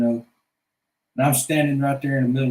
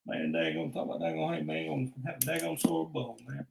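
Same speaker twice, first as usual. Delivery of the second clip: mumbled Boomhauer; 5 semitones lower, 11 dB quieter than usual. Acted.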